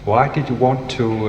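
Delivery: normal talking voice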